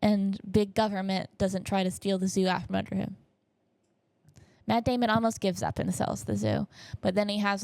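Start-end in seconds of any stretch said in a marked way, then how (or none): none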